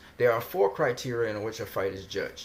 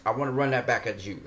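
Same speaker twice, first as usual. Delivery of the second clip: normal talking voice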